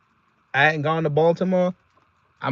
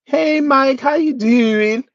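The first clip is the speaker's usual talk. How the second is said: high, drawn-out Baltimore woman impression; exaggerated female Baltimore accent, high-pitched; mock Baltimore accent; 8 semitones higher, 4 dB louder, much slower than usual. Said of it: "That Baltimore accent from a female".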